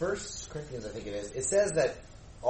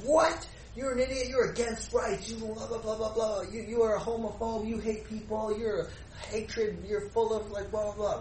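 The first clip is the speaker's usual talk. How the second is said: imitating a furious critic, voice pitched up; mock angry attacker; 7 semitones higher than usual. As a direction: rattle off accusations in a raised, high mock outrage.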